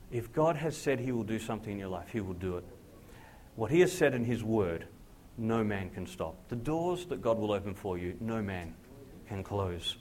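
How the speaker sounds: ordinary talk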